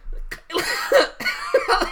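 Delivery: high burst of laughter. Real, not acted. Laughing at his own disease joke, high cackle. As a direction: crack up at the joke.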